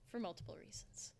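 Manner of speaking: soft conspiratorial whisper; whispered aside. Real, not acted. Teasing chat with a whispered hint. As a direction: breathe it like a secret.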